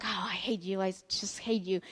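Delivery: softly grumbled mock envy; a quiet, playful "I hate you" of envy; mock envious grumble. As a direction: toss it off quietly with mild envy. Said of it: "I have heard it, you know, like".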